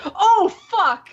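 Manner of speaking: mock a friend's loud, startled swearing; retold shocked reaction. Telling how Sean reacted, retold shock. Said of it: "he was like".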